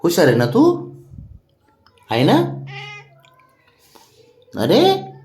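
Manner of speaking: squeaky high praise, rising questions. Real, not acted. Owner praising sulking pet to coax him, high cooing.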